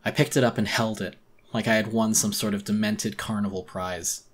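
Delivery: ordinary delivery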